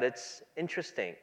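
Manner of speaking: ordinary talk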